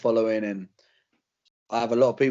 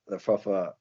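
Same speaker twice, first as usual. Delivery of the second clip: ordinary talk, nothing marked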